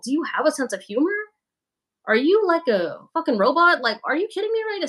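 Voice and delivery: high-pitched exasperated disbelief; mock incredulous. Streamer to commenters who missed the joke, mock incredulous. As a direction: challenge them in exaggerated disbelief.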